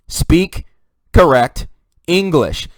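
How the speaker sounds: "Speak", "correct", "English" each punched high and loud with pauses; emphatic word by word. Real, not acted. Spelling out the main message emphatically.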